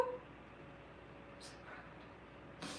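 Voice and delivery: whispered pride. Pretend. Whispered after sung praise.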